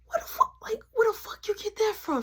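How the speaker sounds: high, incredulous what-the-fuck; stunned disbelief. Retold or imitated. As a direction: replay how shocked you were at her accusation. Retelling shocked reply to accusation.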